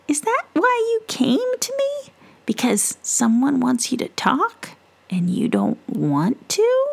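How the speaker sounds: high gentle witch. Acted.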